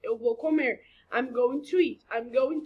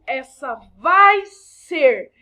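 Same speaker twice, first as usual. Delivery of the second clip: "vai" loud and drawn out; slow, booming emphasis on "vai ser"; emphatic stretch; 11 dB louder, much slower than usual. Shown as a demonstration. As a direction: punch and stretch "vai", then land on "ser". Echoes the hyped example translation.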